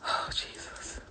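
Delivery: whispering in disgust; whispered dread. Real, not acted. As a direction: breathe it out in horror.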